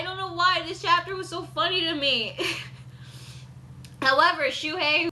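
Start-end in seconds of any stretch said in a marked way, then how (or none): 2.4-3.9 breathless, airless laugh; real silent wheeze of laughter; wheezing silent laugh. Real, not acted. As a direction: laugh with no voice behind it. Cracking up at the chapter.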